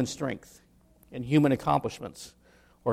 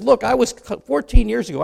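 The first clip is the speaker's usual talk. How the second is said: excited Paul; 8 semitones higher, 7 dB louder than usual. Retold or imitated. Preacher voicing Paul's excited boast.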